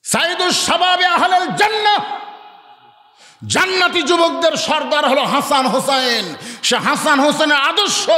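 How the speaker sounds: high, shouted declamation; ringing sermon shout; fiery preaching. Real, not acted. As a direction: proclaim it forcefully to a big crowd. Preaches at full force.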